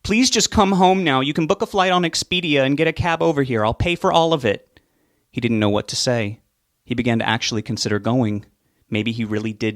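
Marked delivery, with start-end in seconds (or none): 0.0-4.8 louder, higher urgent demand; angry plea. Acted. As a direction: insist he come now. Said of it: "She was beginning to sound angry".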